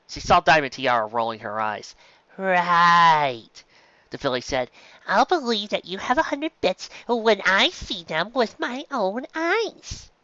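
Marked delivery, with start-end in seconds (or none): 2.4-3.6 louder, sneering little filly; scoffing. Acted. Spoiled filly scoffs at Dinky. 5.1-10.1 higher snooty filly, dragging out the end; taunting. Acted. Spoiled filly taunts Dinky.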